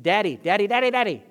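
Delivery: put-on high, loud whiny child calling daddy; kid whining for daddy. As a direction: whine it like a sleepy kid at night. Kid calling dad at night.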